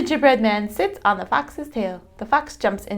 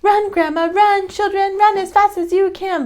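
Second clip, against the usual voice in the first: a much higher, louder taunting shout; gingerbread man shouting; 12 semitones higher, 4 dB louder than usual. A narrator's reading. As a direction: call out gleefully to the chasers. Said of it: "laughs and shouts".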